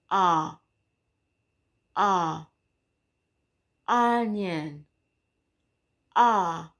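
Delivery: "Ah" with the mouth wide open; open short o. Shown as a demonstration. Mwalimu akitamka sauti fupi ya O kwa kinywa wazi. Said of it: "Unafungua kinywa chako wide open".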